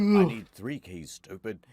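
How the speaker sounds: loud, slow, fed-up yell; exasperated shout. Acted. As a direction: bellow it at the idiot. Snake snapping at Otacon.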